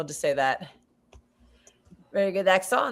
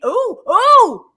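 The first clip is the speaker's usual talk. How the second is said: excited hooting; 12 semitones higher, 8 dB louder, much slower than usual. Real, not acted. Reacting to a goal while watching.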